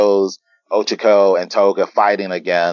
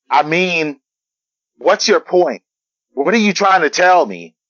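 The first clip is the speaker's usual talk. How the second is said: higher-pitched, pressing questions; exasperated challenge; 8 semitones higher than usual. Acted. Uraraka challenging Toga mid-fight, exasperated. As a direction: demand an answer, pitch jumping up.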